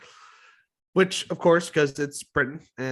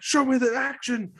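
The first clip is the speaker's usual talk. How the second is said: high-pitched mock pleading at the TV; frustrated; 11 semitones higher, 4 dB louder than usual. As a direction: demand it louder and higher, reliving the frustration. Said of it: "But I was getting frustrated".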